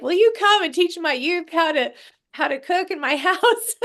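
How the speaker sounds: bright, high eager plea; high excited pitch to a stranger; eager ask. Retold or imitated. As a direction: ask like you can't wait for a yes.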